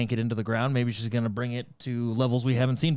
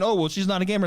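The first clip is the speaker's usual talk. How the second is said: mocking; 7 semitones higher, 4 dB louder, faster than usual. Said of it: "those same people are now saying".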